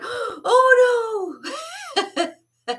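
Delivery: high, loud, panicked oh no; horrified cry. Retold or imitated. Kid horrified at hurting the janitor, high panicked cry. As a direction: gasp it out in shock and dread.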